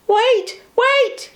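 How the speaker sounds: high, loud call to wait; tooting call. A narrator's reading. Bertie calling after the train, high and loud. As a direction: toot out a desperate wait. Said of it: "he tooted".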